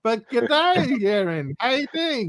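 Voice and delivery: broad, hearty Australian hello; Australian accent. Shown as a demonstration. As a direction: lay on the Australian accent on request. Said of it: "Can you do your Australian accent?".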